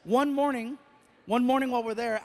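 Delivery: usual speaking voice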